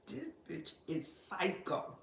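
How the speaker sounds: slow, soft hushed aside; a low conspiratorial aside. Retold or imitated. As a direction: drop low and slow like a secret. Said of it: "I said".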